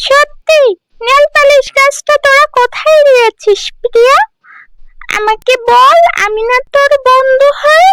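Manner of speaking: put-on very high, shrill-pitched taunting girl; nosy village girl. Village girl quizzing Priya about her new dress, taunting. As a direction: demand an answer like a jealous neighbour girl. Friend prying.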